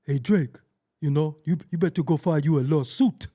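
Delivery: mock accent, urging over the phone; Sean. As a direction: imitate a caller advising, exaggerated lawsuit. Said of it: "Sean was on the phone like".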